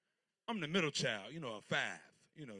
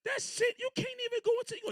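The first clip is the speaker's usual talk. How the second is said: high-pitched outraged squeal; outraged disbelief; 4 dB louder than usual. Real, not acted. Outraged at humping bunny dance.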